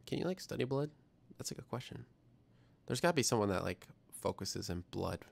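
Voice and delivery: usual speaking voice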